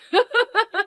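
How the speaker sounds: a short giggle, pitched high; amused high laugh. Acted. Friend laughing about the strong accent, high giggle. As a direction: burst out laughing at the silly accent.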